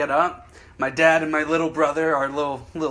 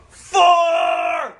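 louder, higher yell of "Four!"; golf warning shout; 12 semitones higher, 6 dB louder than usual. Retold or imitated. Golfer yelling a warning to players on the green, loud shout. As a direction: call it out across the course. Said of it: "And I go".

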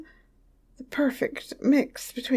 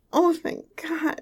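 normal talking voice

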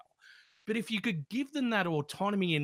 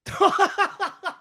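high burst of laughter; 7 dB louder than usual. Real, not acted. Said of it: "You just sound like a smoker".

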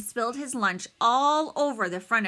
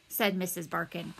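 plain reading voice

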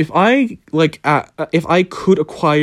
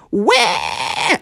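high mock scream of a startled girl; cousin's retold scream, high and short; imitated scream; 6 semitones higher than usual. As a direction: let out a quick startled shriek. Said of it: "we just hear her scream".

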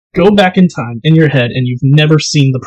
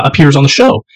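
ordinary delivery

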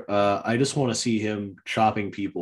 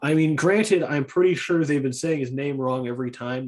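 ordinary talk

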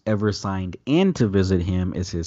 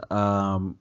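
ordinary talk, nothing marked

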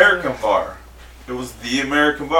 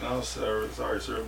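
much softer, sheepish; meek polite refusal; 5 semitones lower, 14 dB quieter than usual. Retold or imitated. Teller refusing the pricey bottle service, meek and soft. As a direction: back out politely under your breath. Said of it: "And I was just like".

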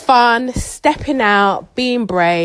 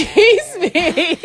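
mock offended; 10 semitones higher, 4 dB louder, much slower than usual. Mother mock offended by daughter.

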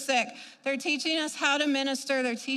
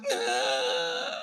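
retold cry; 12 semitones lower than usual. Retold cry during intercession.

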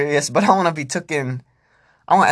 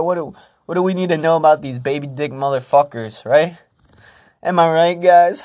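mock pissed-off; 3 semitones higher than usual. Comic imagining China angry over the TikTok ban, mock pissed-off. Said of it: "China's gonna be real pissed off".

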